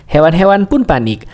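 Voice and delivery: plain reading voice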